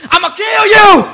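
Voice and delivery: very high, very loud mock yell; hammed-up scream. Jealous King Saul yelling at David, hammed-up loud scream. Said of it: "King Saul would be like".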